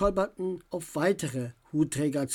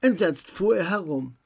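usual speaking voice

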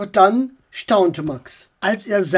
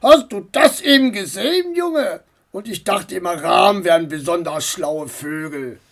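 amused driver. Acted. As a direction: crow over the silly raven. Driver laughing at bird.

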